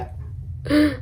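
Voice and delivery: sudden sharp hiccup gasp; a real hiccup catching the breath. Real, not acted. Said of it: "sorry, I have hiccups".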